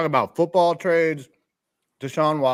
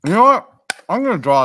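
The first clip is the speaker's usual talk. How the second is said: excited; 7 semitones higher, 8 dB louder than usual. Real, not acted. Sudden idea, jumps up.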